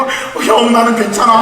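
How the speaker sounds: put-on high child's voice insisting he's fine; brave little brother. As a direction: voice the younger son reassuring his big brother. Father voices younger son.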